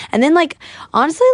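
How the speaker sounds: ordinary talk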